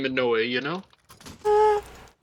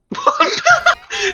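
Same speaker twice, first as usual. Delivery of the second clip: high-pitched laugh. Real, not acted. Laughing at a teammate's voice.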